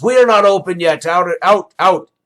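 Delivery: high, loud gruff shooing. Acted. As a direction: chase them out the door. Barkeep shoos the player out.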